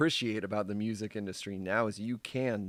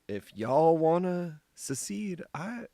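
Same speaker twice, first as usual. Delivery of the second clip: put-on Southern accent; 6 semitones higher, slower than usual. Mock Southern y'all.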